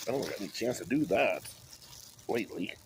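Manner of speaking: usual speaking voice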